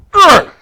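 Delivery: sharp high startled yelp; loud, high yelp of shock; startled gasp. Acted. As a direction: jolt as if punched in the arm. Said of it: "prompting a startled gasp".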